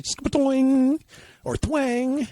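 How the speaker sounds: high, loud sound effect; boing it out like a spring; springy twang. Retold or imitated. Demonstrating how the released stick snaps back, springy. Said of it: "imitating the stick's sound".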